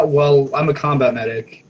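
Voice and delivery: ordinary delivery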